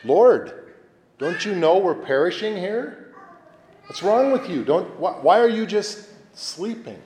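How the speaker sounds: pleading, rising high and urgent toward heaven; a frightened cry to a sleeping Lord; exasperated plea to God. Pretend. Praying in the storm, demanding God wake up, high anxious plea. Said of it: "might say something even subliminally like".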